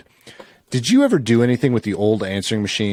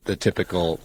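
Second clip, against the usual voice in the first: ordinary talk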